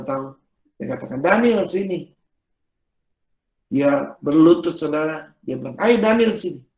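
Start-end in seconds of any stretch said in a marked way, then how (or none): 1.2-2.1 calling out. Retold or imitated. Father calls his son over. 5.8-6.7 raised imitated call, falling away at the end. Forgiving father calls Daniel to him, raised beckoning call.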